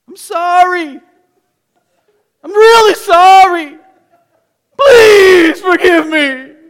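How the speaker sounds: loud, high, slow hammed-up crying plea; fake-sob the apology to win mom over; overdone fake apology. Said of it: "I'm working it up".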